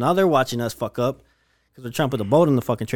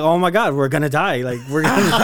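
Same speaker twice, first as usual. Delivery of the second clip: raised, louder mock panic, half laughing; 3 semitones higher, 4 dB louder than usual. Retelling the barge coming at them, in mock panic. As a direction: act out thinking you're about to die. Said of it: "And I was like".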